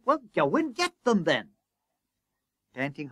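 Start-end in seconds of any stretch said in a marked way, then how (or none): 0.0-1.5 high, impatient retort; harassed boss, pitched high; exasperated order. A narrator's reading. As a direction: cut off the excuse sharply. Exasperated boss snapping back.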